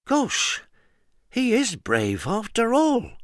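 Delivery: high, slow, hushed wonder; a grudging awe. A narrator's reading. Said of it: "she said in awe".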